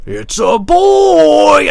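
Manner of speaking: high, loud, very slow screech; drawn-out falsetto movie impression; horror movie voice. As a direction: stretch it out as a monstrous shriek. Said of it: "Nightmare on Elm Street Part Five".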